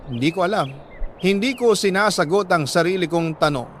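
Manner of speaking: usual speaking voice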